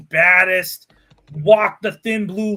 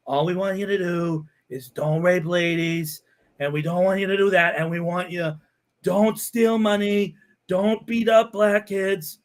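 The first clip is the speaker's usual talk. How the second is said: a hint of Trump, half-hearted; Trump impression; 2 semitones lower than usual. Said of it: "if I talk with Trump's voice".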